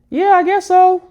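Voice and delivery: put-on very high, loud little-kid voice; imitated preschooler piping up; four-year-old brother. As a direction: squeak it out like a small child. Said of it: "the four-year-old brother".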